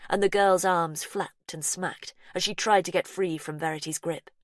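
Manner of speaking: plain reading voice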